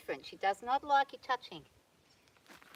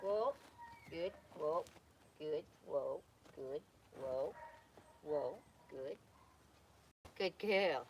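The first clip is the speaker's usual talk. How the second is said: much lower and softer, steady and calming; soothing whoa; 9 semitones lower, 8 dB quieter, slower than usual. Real, not acted. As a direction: ease the horse down with low murmurs. Calming a horse on the rope.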